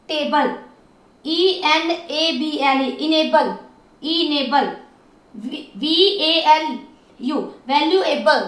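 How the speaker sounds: normal talking voice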